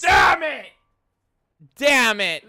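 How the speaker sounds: loud, high outburst; real frustrated yelling; frustrated shout. Real, not acted. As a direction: yell it out in defeat. Streamer losing to the joke.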